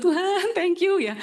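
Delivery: high, slow, drawn-out cry of thanks; a hint of yelling with joy on the road; joyful shout. Riding home after the sale, mock joyful shout to God. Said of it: "teriak-teriak di jalan Kenjeran".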